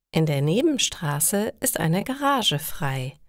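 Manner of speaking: ordinary talk, nothing marked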